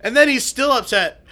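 normal talking voice

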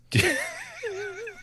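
squealing laugh; high crying laugh. Real, not acted. Mocking laugh at the secret.

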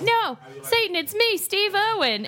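high-pitched Australian accent impression; Steve Irwin. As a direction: put on a high, drawn-out Aussie accent. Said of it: "that's a great Australian accent".

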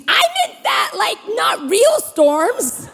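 very high mock wail; high whiny protest, dragged out at the end; panicked protest. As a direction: squeal the objection in comic despair. Acted protest to Jesus in panic.

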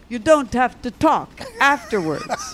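high-pitched mock Czech accent; attempted Czech accent. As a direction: try a Czech accent, badly, for laughs. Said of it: "trying to do a Czech accent".

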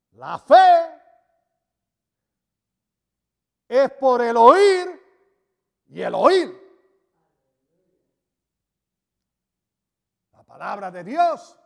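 high, loud, slow proclamation of the verse; preacher thundering the key verse; full-force preaching. Acted. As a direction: proclaim it to the back row. Climax of preaching.

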